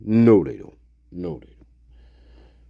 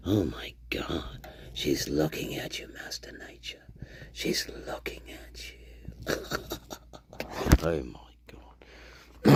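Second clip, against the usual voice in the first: awed whisper; 6 semitones lower, 15 dB quieter than usual. Real, not acted. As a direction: whisper as if she might hear. Whispering about card.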